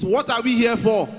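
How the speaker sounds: loud, high rallying shout; scream it. Shown as a demonstration. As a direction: shout it to fire up the crowd. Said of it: "I want to scream it".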